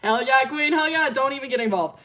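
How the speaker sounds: loud, high cheer; cheering the character on from the sidelines. Real, not acted. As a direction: hype her up loudly.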